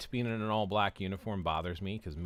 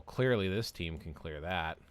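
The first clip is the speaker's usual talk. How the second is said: ordinary talk, nothing marked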